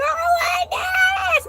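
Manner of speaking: put-on a small kid screaming in fright; terrified child. Scared child shrieking at the jack in the box toy.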